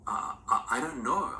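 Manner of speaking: high, flustered stammer; a startled grandchild stammering to grandfather. A narrator's reading. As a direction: stumble over the words, caught out. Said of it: "I stammered".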